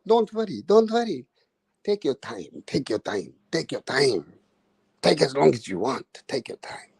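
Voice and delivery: mock the referee babying the fighter; mock soothing referee. Ranter mimics referee soothing Usyk, soft mock reassurance. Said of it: "the referee was like".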